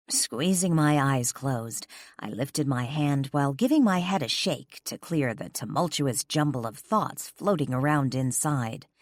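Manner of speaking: plain reading voice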